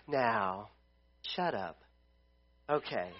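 low, soft Bob Ross calm; mock Bob Ross, low and soft. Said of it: "if we complained to Bob Ross, he'd be like".

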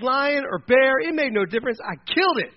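high, loud declaration; triumphant boast. Acted. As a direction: land it like a proud young fighter. David boasts of killing beasts.

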